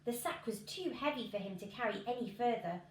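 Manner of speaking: plain reading voice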